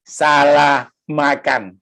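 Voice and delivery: slow, deliberate punchline; mock-grave reveal, said very slowly; drawn-out confession. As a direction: stretch out each word of the confession.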